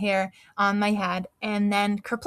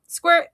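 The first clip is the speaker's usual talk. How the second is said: high, loud playful squirt sound; pretend squirting; 9 semitones higher, 8 dB louder than usual. Girl pretending to spray seltzer, playful squirt noises. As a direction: make a toy seltzer noise. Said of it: "pretended to squirt myself with seltzer".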